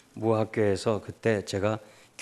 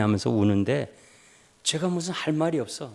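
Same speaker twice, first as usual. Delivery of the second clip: usual speaking voice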